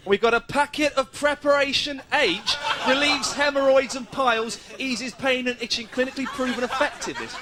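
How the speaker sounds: hammed-up ad voice; mock ad read. Reporter reads the Preparation H packet aloud in a mock sing-song ad voice.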